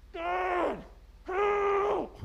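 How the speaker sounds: very high, soft falsetto cry; mock holler for help. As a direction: imitate a distant yell in a thin high voice, held back in volume. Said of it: "hollered as loud as I can holler".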